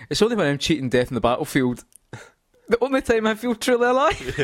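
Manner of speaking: lightly voiced Snake, rising to a laugh on alive; hammed-up soldier line breaking into laughter; Snake's line, first take. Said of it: "I'll repeat that".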